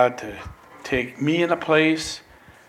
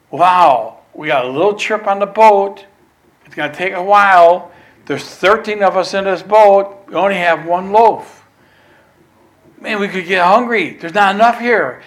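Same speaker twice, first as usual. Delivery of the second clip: lightly acted fretting, louder and higher; worried chatter; 5 semitones higher, 7 dB louder than usual. Disciples fretting over one loaf, lightly acted worry. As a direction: voice mounting mock worry in a raised, louder tone.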